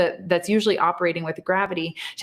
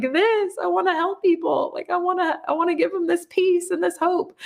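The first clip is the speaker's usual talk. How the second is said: much higher, pleading through tears; tearful plea; 11 semitones higher than usual. Retold or imitated. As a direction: beg him to understand, choked up and high.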